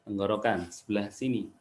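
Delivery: usual speaking voice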